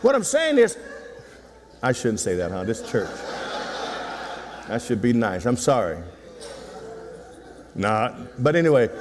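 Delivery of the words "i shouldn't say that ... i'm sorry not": mock apology. Pastor pretends to apologise, then "Not.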